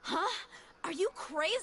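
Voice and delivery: sudden high, alarmed cry; shocked outburst. Acted. A mercenary has bitten his tongue, shocked cry of disbelief. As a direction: jolt up in disbelief at what he did.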